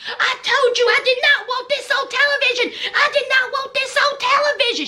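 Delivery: high, fast, exasperated retort; exasperated yelling. Retold or imitated. Husband yelling back at his wife, exasperated. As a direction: fire back in disbelief. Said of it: "Troy was like".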